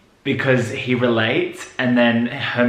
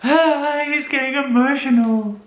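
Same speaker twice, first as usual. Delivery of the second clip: sympathetic coo; 12 semitones higher than usual. Real, not acted. Reactor moved by Marcus's emotion, high sympathetic coo. Cooing over character on screen.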